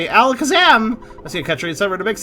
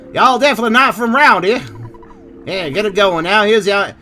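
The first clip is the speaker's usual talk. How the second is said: put-on Texan accent; 3 semitones higher, 5 dB louder than usual. Gamer reading a Moonside local's lines in a hammed-up Texan accent. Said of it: "everybody is Texan".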